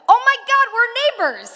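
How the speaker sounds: high, loud delighted gasp of recognition; loud and high, overjoyed to find a neighbor; excited. Retold or imitated. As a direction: burst out with thrilled surprise. Excited retold reaction to neighbor.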